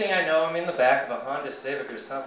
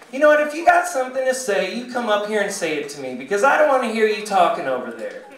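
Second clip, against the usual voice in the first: higher, louder challenge; scolding desk lady; 5 semitones higher, 3 dB louder, faster than usual. Acted. Desk lady to the mumbling patient, scolding challenge. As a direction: dare him to come up, as the desk lady. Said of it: "and she goes".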